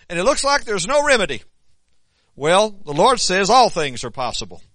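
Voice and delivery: high, loud preaching at full force. Acted.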